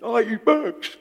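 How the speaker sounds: the cocky frog shouting its greeting; boastful frog. Acted. Preacher playing the frog meeting the elephant in a high, loud squeaky voice.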